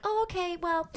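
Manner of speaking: high, breezy brush-off; chirpy princess exit. Retold or imitated. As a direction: sing it out high and cheerful to end the chat. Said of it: "she was like".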